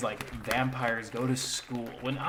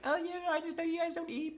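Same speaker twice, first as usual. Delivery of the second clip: much higher, airy mock Bella brushing it off; 3 dB quieter than usual. Mocking Bella to the vampire family, high and breezy. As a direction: squeak it out like a dismissive teen girl.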